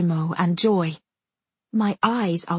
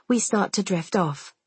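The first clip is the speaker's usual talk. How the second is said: plain reading voice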